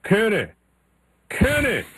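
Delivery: high mock calling of a name; imitating Ward Burton. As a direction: call out like a drawling racer wanting attention. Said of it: "all of a sudden I hear".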